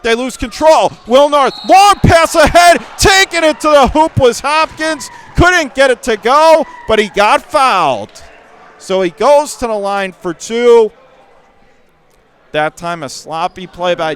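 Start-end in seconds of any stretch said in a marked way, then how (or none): 0.0-10.9 excited call. Real, not acted. Live call of a fast break.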